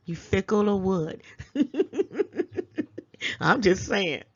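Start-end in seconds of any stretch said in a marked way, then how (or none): 1.4-3.3 a short high laugh at his own jab; high amused laugh. Real, not acted.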